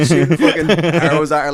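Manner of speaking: laughing through the words; cracking up while talking. Real, not acted. Laughing while telling the scene.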